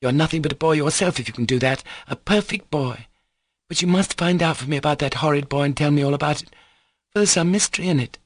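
quick, high-pitched reproach; hurried, controlling insistence; bossy scolding. Acted. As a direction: lay down the law as a cross girl. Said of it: "as though she had a right to control him".

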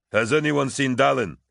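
sudden loud, high call. A narrator's reading. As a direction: call across the room for someone missing. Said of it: "Collier called out".

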